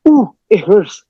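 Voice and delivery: mimicking someone who can't take a light touch; picky complainer. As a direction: play an oversensitive person making a fuss. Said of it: "so picky".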